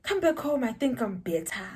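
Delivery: ordinary talk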